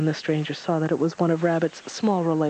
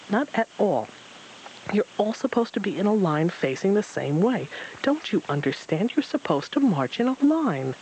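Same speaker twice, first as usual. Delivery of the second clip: lightly voiced fussy Rabbit, higher; a hint of a frantic rabbit scolding; agitated Rabbit; 5 semitones higher than usual. A narrator's reading. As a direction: Rabbit scolding his muddled army. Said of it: "Rabbit screamed".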